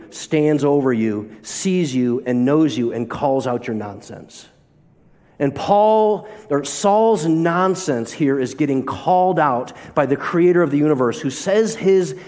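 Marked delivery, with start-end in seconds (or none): none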